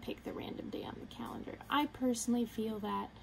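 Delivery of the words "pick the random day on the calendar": softer, breathy whispered correction; whispered aside. Real, not acted. Whispered correction of previous line.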